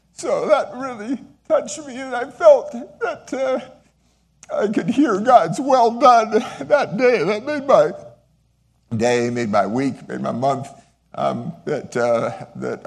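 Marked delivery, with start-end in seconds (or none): 0.1-7.9 deeply moved. Real, not acted. Moved recalling student's words.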